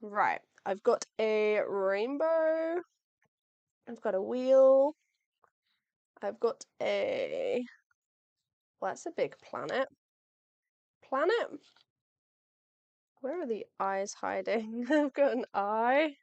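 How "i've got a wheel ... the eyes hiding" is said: normal talking voice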